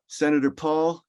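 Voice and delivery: put-on a light take on an exasperated official, accent left out; quoting a testifying official scolding a senator, mildly indignant; Tony Fauci, no accent. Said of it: "I'm not gonna do the Brooklyn accent".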